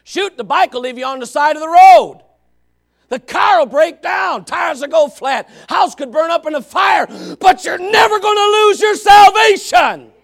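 loud, high, building shout; preaching at full force. Real, not acted. Preacher proclaiming the sacrifice to the congregation, shouting. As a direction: preach it with everything you've got. Climax of sermon.

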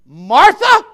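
loud, stern call of her name; stern shout. Acted. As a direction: call her sternly, far above talking volume. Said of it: "I think he said it sternly".

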